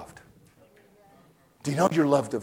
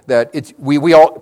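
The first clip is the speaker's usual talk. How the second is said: usual speaking voice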